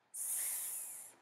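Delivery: long whispered hiss; anaconda's breathy hiss; snake hiss. Acted. Anaconda appears hissing.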